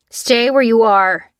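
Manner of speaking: loud, slow command; amplified shout. Acted. Soldier shouting at Lucy from the jeep, loud. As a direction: bellow it through a loudspeaker. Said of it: "came an amplified shout".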